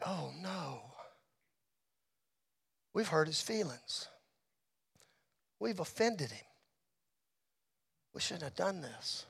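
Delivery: very soft, low regret; retold sinking realization, barely voiced; hushed and remorseful, with long pauses; hushed dismay. Retold dismay at hurting his feelings.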